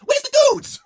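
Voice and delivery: shouting the question in a squeaky voice; high yelp. Pretend. Comic yelled question.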